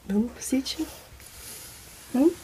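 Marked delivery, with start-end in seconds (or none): 0.1-0.8 sussurro baixinho para não assustar; sussurrando. Real, not acted. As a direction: fala só com o ar, bem de mansinho. Acordando alguém, sussurra. (cochichando ao acordar alguém)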